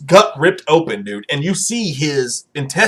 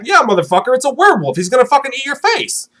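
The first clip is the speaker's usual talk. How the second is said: gleeful jeer; 6 semitones higher than usual. Retold or imitated. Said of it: "I was like".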